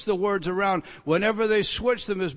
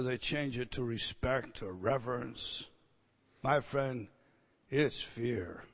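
much softer, intimate earnest tone; quiet insistence; 6 semitones lower, 10 dB quieter, slower than usual. Real, not acted. Preacher confiding to listeners, soft and earnest. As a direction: lower the voice to press it home.

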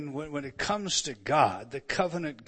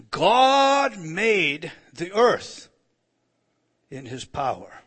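very slow, loud "God made the earth"; solemn loud proclamation of "God made the earth"; intoned scripture; 3 semitones higher, 11 dB louder, much slower than usual. Acted.

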